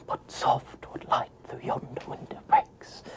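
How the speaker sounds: mock theatrical Shakespeare line, breathed with no voice; hushed Shakespeare recital. Actor mocking traditional Shakespeare, whispered theatrically. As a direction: send up the old-fashioned stage Romeo. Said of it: "if you're gonna do Shakespeare".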